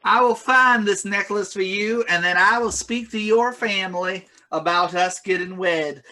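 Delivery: put-on a dazzled young man proposing; smitten NPC.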